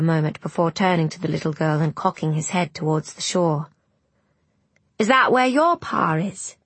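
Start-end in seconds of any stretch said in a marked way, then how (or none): 5.0-6.6 put-on higher-pitched boy's question; curious boy. A narrator's reading. Boy asks the girl about the shore, curiously. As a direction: ask like an inquisitive lad.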